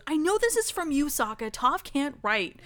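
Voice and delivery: mock accusation. Katara calling out Sokka over the forged note, accusing. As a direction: play someone seeing straight through a trick.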